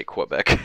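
"Quebec" with a w after the k; "Quebec" starting on a "kw" sound; Quebec as kwuh-BEC. Shown as a demonstration. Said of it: "But if you're American".

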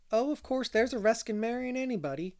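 high, lightly voiced concession; conceding. A narrator's reading. Charlotta admits marriage is a risk, conceding. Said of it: "conceded Charlotta the Fourth".

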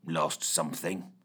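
low, slow growl. A narrator's reading. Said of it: "growled Fitch".

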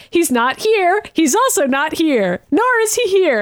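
lightly acted team calling in; mock search reports. X-Men reporting back to Xavier, lightly mocked.

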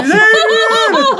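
high, slow, laughing call; yelling Adrian. Retold or imitated. Rocky shouts for Adrian, laughing high yell. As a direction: yell her name through laughter. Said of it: "he's just yelling".

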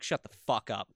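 normal talking voice

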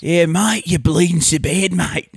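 a quick mock Australian drawl on request; playful Australian accent for the podcast hosts. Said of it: "Can you do it in your Australian voice".

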